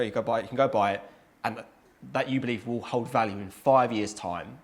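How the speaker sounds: normal talking voice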